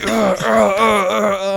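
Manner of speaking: high, loud hammed-up grunts; mock grunting sobs. Imitating the drunk guy grunting while punching. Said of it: "He's like".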